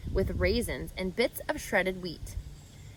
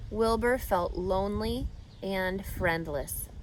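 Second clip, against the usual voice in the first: ordinary delivery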